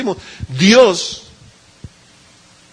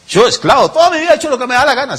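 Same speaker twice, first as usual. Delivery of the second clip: mock indignant protest; 8 semitones higher, faster than usual. Voices a proud listener's objection.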